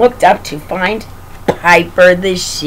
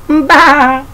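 loud, higher-pitched baa; high little lamb's bleat; 6 semitones higher, 5 dB louder than usual. A narrator's reading. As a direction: bleat like a small lamb. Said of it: "says little lamb".

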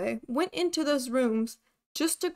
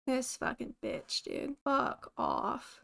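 muttered cursing; 7 dB quieter, slower than usual. Real, not acted. Player fed up with the ghost, muttered cursing. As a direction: curse under your breath. Grumbling after shouting at ghost.

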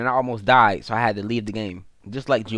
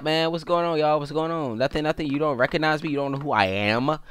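usual speaking voice

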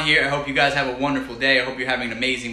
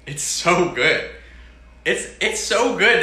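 drawn-out delight; 5 semitones higher, 4 dB louder, slower than usual. Real, not acted. Savoring food, drawn out.

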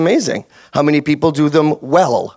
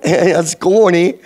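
Canadian; 2 semitones higher than usual. Acted. Repeats the Canadian greeting act.

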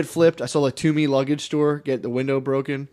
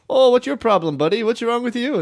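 high, loud mock Minnesota challenge; hammed-up Minnesota accent, raised pitch; 10 semitones higher, 3 dB louder than usual. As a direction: imitate an affronted Minnesota guy. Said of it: "the Minnesota guy was".

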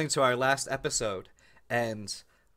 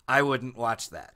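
ordinary delivery

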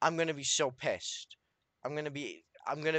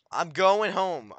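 loud insistence; 4 semitones higher, 6 dB louder, slower than usual. Real, not acted. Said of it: "leave me alone, Enderman".

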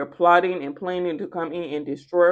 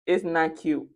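ordinary talk

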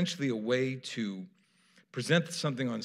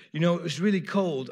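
retold Manchester bar guy; 5 semitones higher, 5 dB louder than usual. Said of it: "I'm not gonna try to do their accent".

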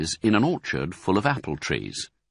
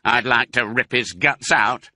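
louder, gruff farmer voice; Bunce; 5 semitones higher, 5 dB louder than usual. Acted. Bunce vowing revenge on the fox, louder menacing voice. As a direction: growl it with menace. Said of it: "said Bunce".

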